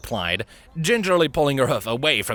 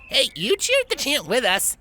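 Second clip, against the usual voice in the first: protesting. A narrator's reading. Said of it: "the Pegasus protested".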